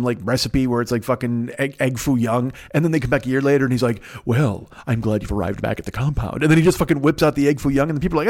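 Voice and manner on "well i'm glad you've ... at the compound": low, measured creepy-host impression; lightly voiced posh count. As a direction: imitate an ominous, hypnotic aristocrat. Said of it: "his voice is, uh, it's, it's hypnotic".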